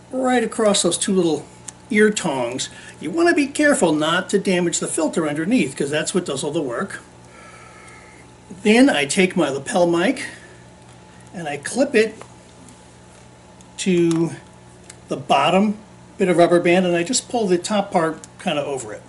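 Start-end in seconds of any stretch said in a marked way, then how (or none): none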